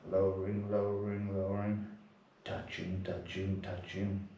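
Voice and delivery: soft, low, steady chanting of each movement; name each motion slowly and quietly; meditative noting. Acted. Noting during mindful prostrations.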